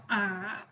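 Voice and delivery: deep, low AH; "ah" said low and soft; third tone. Shown as a demonstration. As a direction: drop "ah" down deep in the voice. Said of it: "The third tone is deep".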